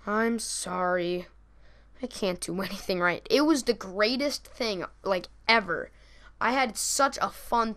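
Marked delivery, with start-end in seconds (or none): 0.1-3.2 mock sulky apology. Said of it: "old people get mad".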